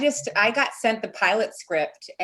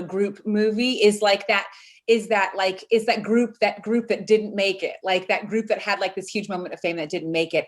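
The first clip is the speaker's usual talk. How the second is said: ordinary delivery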